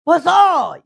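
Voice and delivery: loud call across the house; yelling for husband. Retold or imitated. Young wife yelling to husband about visitor, loud call. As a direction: shout for him to come out. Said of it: "여보 소리를 못하니까".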